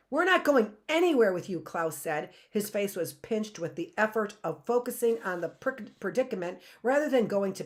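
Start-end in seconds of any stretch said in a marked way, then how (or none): 0.1-1.6 firm, loud refusal; defiant. Acted. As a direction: stand your ground. Klaus defying Stefano.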